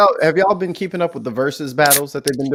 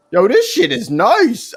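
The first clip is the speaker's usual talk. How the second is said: impressed; 10 semitones higher, 3 dB louder, slower than usual. Retold or imitated. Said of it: "I was like".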